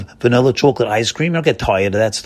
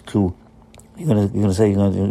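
usual speaking voice

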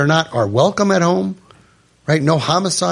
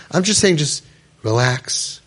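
normal talking voice

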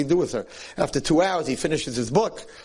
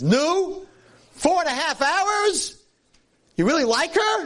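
much higher, delighted disbelief, slow on the hours; a father beaming at his son's news; excited father; slower than usual. Retold or imitated. Father questions his son in the morning, thrilled and high.

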